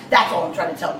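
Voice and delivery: ordinary talk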